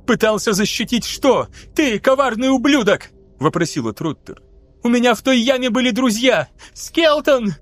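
furious grief cracking on the name; voice breaking. A narrator's reading. Grieving guardswoman confronts the traitor, voice breaking. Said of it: "Её голос сорвался".

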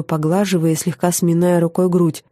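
ordinary delivery, nothing marked

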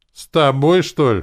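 loud, high, scornful retort; snorting. A narrator's reading. Uncle scoffing at the offer to talk it through, snorting. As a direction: snort it back dismissively. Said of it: "фыркнул дядя Фрол".

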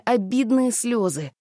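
ordinary delivery, nothing marked

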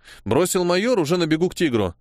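plain reading voice

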